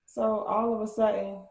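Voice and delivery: normal talking voice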